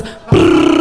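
buzzing rattle on the floor; vibrator rattle. Retold or imitated. Narrator imitating the vibrator rattling on the floor. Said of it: "It hits the hardwood floor and goes".

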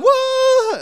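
high, loud falling whoop; excited whoa. Retold or imitated. Friend pushes the boy down the hill, high excited whoop. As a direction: cheer him on as he races downhill. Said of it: "and his friend's going".

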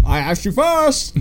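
slow, high-pitched retort; mock argument with an echo, drawn out high; calling out. Fan acts the Grinch arguing with his echo, calling out.

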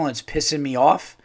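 ordinary delivery

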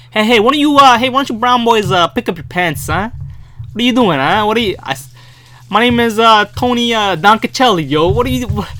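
put-on loud, high tough-cop voice with a Brooklyn accent; New York cop. Imitating a street cop yelling at boys, loud Brooklyn cop. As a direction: hassle the kids like a beat cop. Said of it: "that's like a cop".

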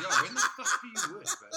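laughing high through the question; teasing while cracking up. Real, not acted. Mocking laugh at friend.

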